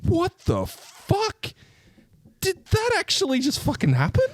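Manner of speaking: high falsetto what the fuck; stunned disbelief. Retold or imitated. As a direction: squeal it in total disbelief. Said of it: "You're just like".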